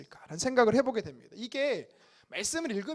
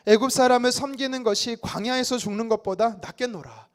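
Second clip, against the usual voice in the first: much louder unison Bible reading; loud group scripture reading; 9 dB louder than usual. Acted. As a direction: read it out together with the congregation. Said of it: "함께 같이 읽겠습니다". (회중과 함께 크게 성경 봉독)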